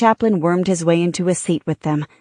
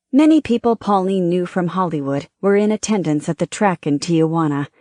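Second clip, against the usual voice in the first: ordinary delivery, nothing marked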